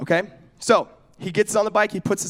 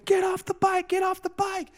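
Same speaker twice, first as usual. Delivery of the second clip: mock screaming; 13 semitones higher than usual. Onlookers to boy on runaway bike, high mock scream. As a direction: imitate panicked onlookers screaming. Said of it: "people are screaming".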